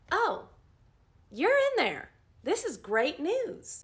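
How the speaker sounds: high, loud, slow gush of delight; delighted surprise. Retold or imitated. Nurse overjoyed to hear patient answering, loud high delight. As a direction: gush at the patient like it's wonderful news.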